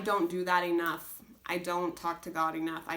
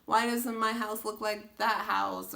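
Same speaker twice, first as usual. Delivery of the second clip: pitched-up self-pity whine; whining; 5 semitones higher than usual. Retold or imitated. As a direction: whine it to yourself like a sulky kid. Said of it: "complaining and whining to myself".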